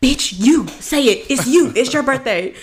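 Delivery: high, insistent mock urging; imitated urging crowd. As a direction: push a shy friend to speak up. Voices the section urging her.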